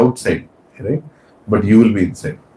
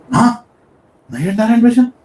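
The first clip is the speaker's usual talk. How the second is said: high-pitched, incredulous outburst; mock shock; 12 semitones higher than usual. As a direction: echo it back in acted disbelief. Acts wife's shock at confession.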